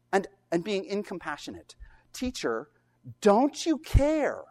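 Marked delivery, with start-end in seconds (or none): 2.1-4.4 accusing plea, drawn out and rising high. Retold or imitated. Disciples reproaching Jesus for apathy, accusing. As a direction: press the reproach slowly, louder on the question. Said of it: "they accuse him of apathy".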